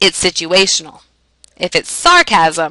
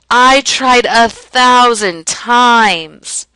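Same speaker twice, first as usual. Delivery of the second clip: very slow, higher, every word hammered; dramatic exaggeration; 4 semitones higher, 3 dB louder, much slower than usual. Shown as a demonstration. Teacher giving a hyperbole example, dramatically exaggerated. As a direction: stretch out THOUSAND TIMES as a big overstatement.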